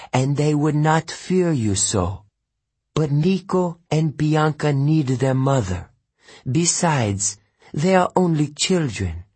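put-on high, gentle Italian-accented mother. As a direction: tenderly reassure him in an Italian accent. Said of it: "laughed gently".

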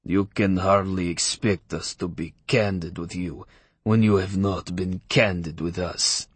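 put-on slow, cold English with a Russian accent; measured Russian-accented rebuke, unhurried; cool Russian accent. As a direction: rabbi coolly rebukes the shopkeeper, Russian accent. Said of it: "He glared at me and said coolly in English with a telltale Russian accent".

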